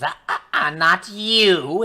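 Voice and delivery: hag voice. Acted. Hag stops the party.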